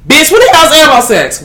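high, loud mock disbelief; sassy shocked viewer asking out loud; incredulous listener. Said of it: "I know you're probably thinking".

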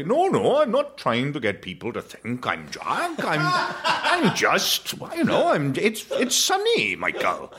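high, slowish put-on posh dad; dad's posh voice. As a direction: raise it up like a Welsh dad playing posh. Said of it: "he'd say".

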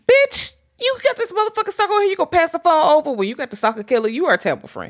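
high, rapid-fire indignant rant; mock outrage. Retelling a movie phone handoff, mock outrage. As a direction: ham up a scolding at a friend.

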